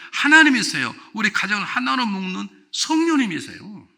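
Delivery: loud, high, ringing preacher's call; fervent pulpit voice at full volume; full-force preaching. Acted. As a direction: preach it out to the whole hall.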